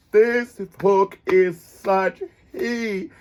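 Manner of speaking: "This hook is such heat" stretched very slow, savoring each word; drawn out. Shown as a demonstration. Savoring the hook for viewers, very slowly. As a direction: draw it out for emphasis.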